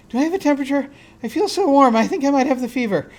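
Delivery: high, loud, fretful worry; panting, feverish nurse. Acted. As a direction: play a nurse panicking that she's sick. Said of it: "she's sort of panting out".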